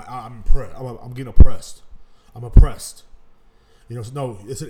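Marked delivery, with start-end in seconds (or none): 0.0-3.0 loud mocking whine of the oppressed; mock the self-pitying complainer, much louder; mocking whiner. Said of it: "they thought they were being oppressed".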